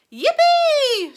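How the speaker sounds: long high whoop; joyful shout. A narrator's reading. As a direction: whoop with glee. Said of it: "shouted Grandpa Joe".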